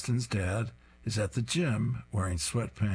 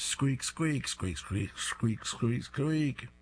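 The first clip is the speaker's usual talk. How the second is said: toy squeaks; 5 semitones higher, much slower than usual. Retold or imitated.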